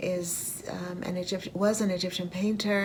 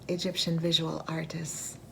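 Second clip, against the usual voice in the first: normal talking voice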